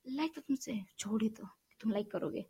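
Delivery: sarcastic whisper under her breath; whispered aside. Real, not acted. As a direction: mutter it as a bitter aside to the viewers. (धीमी फुसफुसाहट में ताना)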